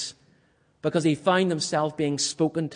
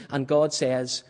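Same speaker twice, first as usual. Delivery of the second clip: usual speaking voice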